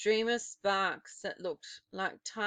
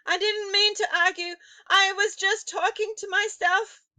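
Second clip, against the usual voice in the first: high, fast panicked excuse; frightened plea; 11 semitones higher, 10 dB louder than usual. A narrator's reading. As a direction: gabble your excuse in terror. Frightened witch pleads.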